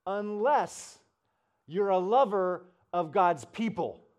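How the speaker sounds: slow, booming pulpit declaration; Rick Warren. Retold or imitated. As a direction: proclaim it loud and high, stretching the words. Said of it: "he goes".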